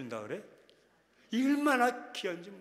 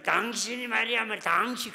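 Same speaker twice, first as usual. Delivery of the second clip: sharp, louder nagging at husband; mock quarrel; 4 semitones higher, 6 dB louder than usual. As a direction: scold the husband, half in jest. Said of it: "그러면 싸움이 더 막 깊어집니다". (남편에게 따지는 흉내, 조금 크게)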